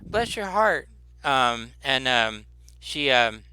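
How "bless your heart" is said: high-pitched, doting woman; touched woman shopkeeper. Acted. Shopkeeper to the child who mended her pot, touched. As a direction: coo over the child's kindness in a lifted voice. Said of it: "And she says".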